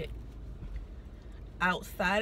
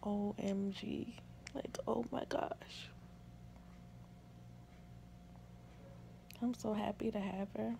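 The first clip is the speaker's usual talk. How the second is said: hushed wonder; 14 dB quieter than usual. Real, not acted. New mother so happy to have her, hushed wonder. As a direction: breathe it out in quiet awe.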